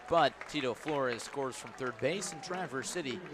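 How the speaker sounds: ordinary delivery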